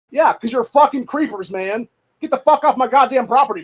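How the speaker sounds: high, loud telling-off of trespassers; mock telling off. To the creepers, a high loud mock telling-off. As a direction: run them off the property. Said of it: "it's like".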